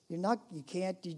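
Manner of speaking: ordinary talk, nothing marked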